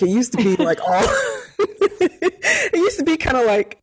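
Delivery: giggling through the words; laughing. Real, not acted. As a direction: laugh while you say it. Laughing while describing body.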